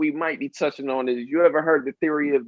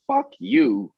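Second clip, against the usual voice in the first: mock New Yorker snap; 13 semitones higher than usual. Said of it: "They'll say, like".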